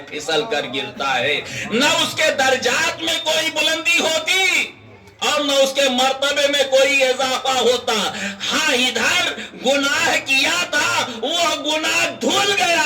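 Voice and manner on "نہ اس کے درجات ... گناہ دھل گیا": high, ringing sermon climax; high-pitched insistent oratory; impassioned preaching. Real, not acted. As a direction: press each clause on a raised pitch. Preacher builds to climax of argument.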